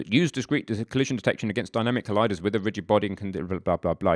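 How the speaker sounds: fast, droning skim of tedious small print; rushed read-out. Shown as a demonstration. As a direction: rattle through dense text, trailing into blahs. Skims dense manual text, trailing into blah.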